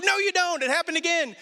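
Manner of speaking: put-on high, bratty child voice; mock a toddler sister teasing; sing-song childish denial; teasing little girl. Sister teasing in retold story.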